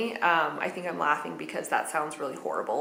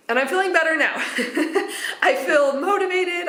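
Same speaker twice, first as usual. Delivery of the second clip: laughing; 9 semitones higher, 5 dB louder than usual. Real, not acted. Laughs while saying it.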